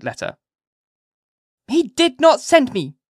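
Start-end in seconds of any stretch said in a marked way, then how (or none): 1.7-3.0 put-on very high, loud child's denial; emphatic little-girl protest; positive cry. A narrator's reading. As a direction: cry it out slowly and firmly, as a child. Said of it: "cried she positively".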